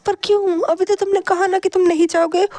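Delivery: suddenly high-pitched, startled objection; alarmed protest. A narrator's reading. Man hearing Wing wants to leave, alarmed protest. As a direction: jump straight in, pitch shooting up. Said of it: "ये सुनकर शिचेन तुरंत कहते हैं".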